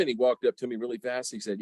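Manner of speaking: ordinary talk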